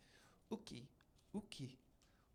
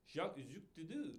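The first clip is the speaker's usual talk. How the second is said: put-on French accent; 8 dB quieter than usual. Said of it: "kinda like a French accent".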